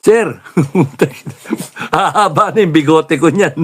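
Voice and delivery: high laughing mock plea. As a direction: laugh through the soldier's complaint. Soldier mocked, laughing through.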